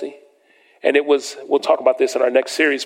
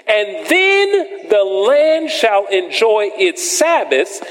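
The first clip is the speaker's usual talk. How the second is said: slow, higher, drawn-out group reading; reading together; 4 semitones higher, slower than usual. Acted. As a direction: lead the church through it word by word. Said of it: "Everybody read this with me".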